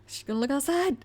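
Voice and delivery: high-pitched squeal of anticipation; mock dread. Viewer dreading the mom's discovery, high mock panic. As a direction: squeak it out in playful alarm.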